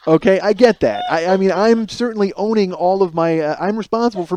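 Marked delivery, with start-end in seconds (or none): 0.1-1.0 chuckling concession; admitting fault with a laugh. Real, not acted. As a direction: say it through a laugh.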